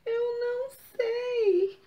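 high, slow, timid little voice; small child voice. Acted. Coquinho tells the fox he doesn't know, timid. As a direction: shrink into an unsure little answer.

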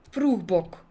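ordinary delivery, nothing marked

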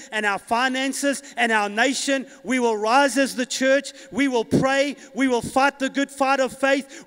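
rally the church, building to a shout; fiery preacher declaring victory; full-force preaching. Acted.

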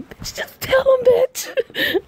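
very high, loud urging; urging shout. Real, not acted. Player yells at the heroine to tell the truth, high and loud. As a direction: yell at her to just tell him. Yelling at game character again.

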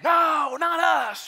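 high, loud crowd reply; mob shouting back. Acted. Acted crowd answers the rabble-rouser.

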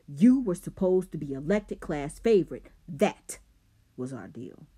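loud accusing anger; angry. A narrator's reading. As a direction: accuse him angrily. Rob angry about deal.

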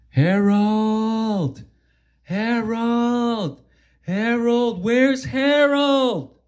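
high calls for a missing friend; calling out. Acted. Characters calling for missing Harold.

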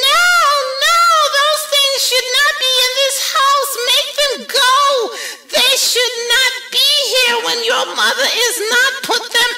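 high, loud, frantic fish; panicky fish voice. A narrator's reading. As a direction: squeal it out in a panic. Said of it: "said the fish in the pot".

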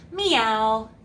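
a playful fake meow for a dog; meowing cat. Mock-meowing to kids as a wrong dog sound. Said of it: "A dog says".

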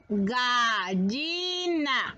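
"Gallina" stretched very slowly, syllable by syllable; slow teacher's "Gallina", every syllable held; drawn-out syllables. Shown as a demonstration. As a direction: draw out each vowel of "Gallina". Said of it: "se van a separar".

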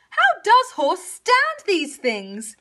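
high, loud, drawn-out complaint; aggrieved high cow wail; bellowing cow. A narrator's reading. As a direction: bellow it slowly in pain. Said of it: "Cow bellowed".

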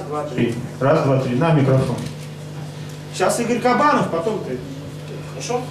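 usual speaking voice

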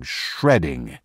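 plain reading voice

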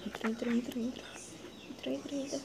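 hurried whisper, entra over and over; hiding dinosaur urging himself into the cave; urgent whisper. Acted. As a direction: whisper it fast to yourself as you hurry in.

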